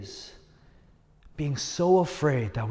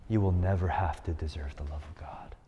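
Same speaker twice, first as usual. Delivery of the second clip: whispered, softer and lower; intimate whisper; 8 semitones lower, 7 dB quieter than usual. Real, not acted. Pastor whispering the gospel to a weeping student, hushed. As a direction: lean in and whisper the good news.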